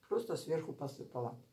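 eating between words; talking while chewing. Real, not acted. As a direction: mouth partly full of salad. Seen 1: Bite at -2.0; chewing mouth shapes between speech through 6.6.

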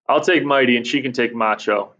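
ordinary delivery

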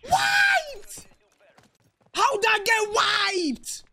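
high frustrated yell at the game; raging gamer. Real, not acted. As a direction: shout it out, fed up and disbelieving. Teammates die, he gets wiped.

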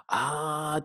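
normal talking voice

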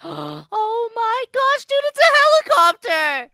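gasps, then shouts it high and loud; overjoyed at finding the way out; excited gasp. Acted. Spotting the escape helicopter.

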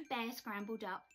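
usual speaking voice

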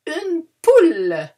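high, loud clucking "Une poule"; drawn-out hen cry. Pretend. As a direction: squawk it like a chicken for the kids. Teacher plays hen sound with word.

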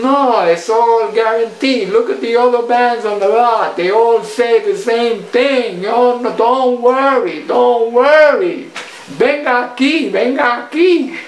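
high, loud, slow pushy salesman reassuring; salesman impression. Salesman soothing a doubtful van buyer, high and loud. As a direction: coax her like a slick car salesman. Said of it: "He goes".

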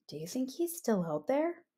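soft cautious question; hushed. Acted. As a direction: ask it softly, peeking out. Pig wonders quietly.

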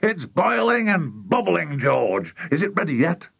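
high-pitched, eager exclaiming; excited cry. A narrator's reading. Mister Cranky urges George on at the saucepan, excited cry. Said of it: "cried Mister Cranky".

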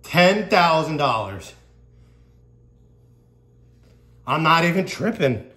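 excited. Real, not acted. Still reeling from the win.

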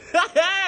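short, high burst of laughter; loud laugh. Real, not acted. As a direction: bust out a loud cackle. Laughing at own crack joke.

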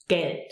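Geld with a brief E as in "bet"; short open E. Shown as a demonstration. Teacher explaining short E to learners, Geld with open E. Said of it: "short and more open".

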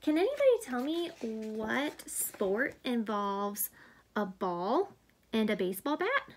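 ordinary delivery, nothing marked

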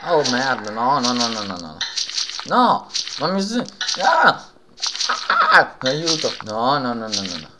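terrified yelps and frantic no's; panicked screaming. Real, not acted. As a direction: scream for help in real panic. Horror game jump scare panic.

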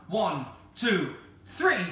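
loud count jumping high on three; counting shout. Acted. Cyril rallies the children for a bigger yell, shouted count.

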